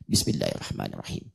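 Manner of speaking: whispered basmalah, barely voiced; silent recitation. Shown as a demonstration. Teacher shows sir reading, whispered. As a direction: mouth it privately, unheard by others. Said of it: "saya bacanya sir".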